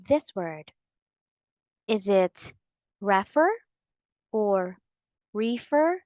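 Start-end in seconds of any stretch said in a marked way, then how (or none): 3.0-3.5 "refer" as REH-fer, stressed up front, rising; wrong first-syllable stress. Shown as a demonstration. Teacher offering learners a possible reading, "refer" misstressed as REF-er. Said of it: "it's incorrect to say refer". 5.3-6.0 "refer" as REE-fer, long ee, rising high; wrong long-e reading. Shown as a demonstration. Teacher offering learners another possible reading, "refer" misread as REE-fer. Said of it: "the stress is on the second syllable, not first".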